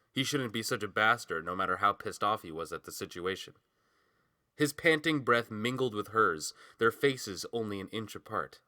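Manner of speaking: ordinary delivery, nothing marked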